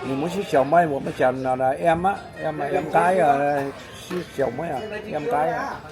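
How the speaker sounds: ordinary talk, nothing marked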